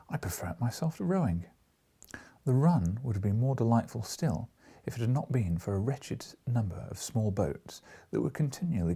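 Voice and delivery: even, lively read-aloud narration; storyteller reading a comic travel novel; story narration. A narrator's reading. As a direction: tell the river journey to the listener.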